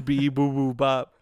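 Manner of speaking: sing-song beep noises mimicking a gadget; imitating beeps. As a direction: chirp out the beep pattern. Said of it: "the one that goes".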